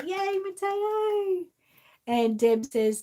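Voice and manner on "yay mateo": high, slow happy cheer; drawn-out cheer. Real, not acted. Greeting a viewer who is ready, cheerful.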